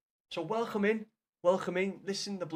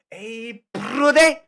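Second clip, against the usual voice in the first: much higher, much louder "brother"; hammed-up shouted "Hey, brother"; shouted mock bro; 6 semitones higher, 15 dB louder, much slower than usual. Shown as a demonstration. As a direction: yell "Hey, brother" as a parody. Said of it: "Stop saying bro".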